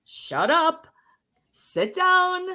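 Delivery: put-on a stern parent shutting a child down; strict adult telling off a child. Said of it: "we were told".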